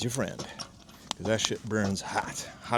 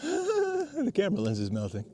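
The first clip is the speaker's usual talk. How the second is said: high, slow words through a laugh; slow laughing squeal; laughing in disbelief; 14 semitones higher, slower than usual. Real, not acted. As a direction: laugh it out, amazed. Lens melting from fire heat.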